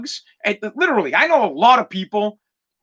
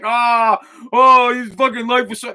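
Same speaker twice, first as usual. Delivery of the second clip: mock sobbing; 4 semitones higher, 3 dB louder, slower than usual. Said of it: "I'm like".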